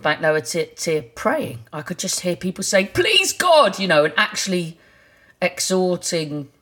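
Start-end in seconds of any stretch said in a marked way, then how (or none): 2.9-3.8 high, loud retold plea; pleading desperately to God. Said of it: "There was a lot of, like, desperation".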